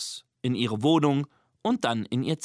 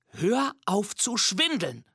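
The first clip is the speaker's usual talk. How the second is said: put-on slow, stern girl, pitched up; 7 semitones higher, slower than usual. A narrator's reading. Girl telling a fibbing monster to stop, stern. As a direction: scold firmly and deliberately. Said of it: "sagt Nina streng".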